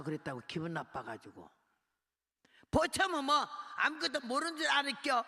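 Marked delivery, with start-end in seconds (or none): 2.7-5.2 Korean guard's huffy retort, higher and louder; offended guard. Retold or imitated. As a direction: bristle at being called a mere guard. Guard offended being called guard.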